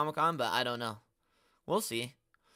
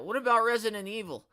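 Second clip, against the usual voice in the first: mock fan question; 7 semitones higher than usual. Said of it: "everyone always asks that".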